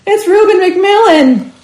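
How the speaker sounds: high, loud, slow call of recognition. A narrator's reading. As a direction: cry out the name. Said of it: "called out".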